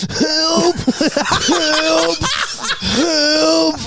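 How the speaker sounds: very high, squealed mock cries for help; comically high, drawn-out wail; funny help voice. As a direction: squeal like a crashed kid, laughing through it. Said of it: "in a kind of funny voice".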